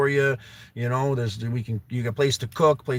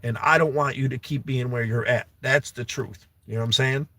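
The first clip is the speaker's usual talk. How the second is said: usual speaking voice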